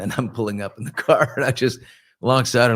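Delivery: normal talking voice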